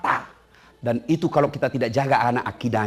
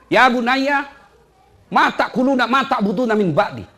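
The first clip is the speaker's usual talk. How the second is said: voicing Ibrahim; 11 semitones higher, 4 dB louder than usual. Ibrahim asks his sons, quoted.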